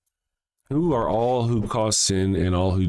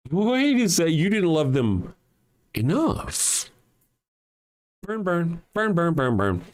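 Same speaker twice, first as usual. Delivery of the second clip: mock Jesus; 7 semitones higher than usual. Mock Jesus condemning neighbor.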